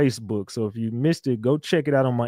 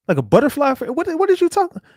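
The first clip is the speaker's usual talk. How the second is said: incredulous squeal; 12 semitones higher, 4 dB louder, faster than usual. Real, not acted. Incredulous at fairy comment.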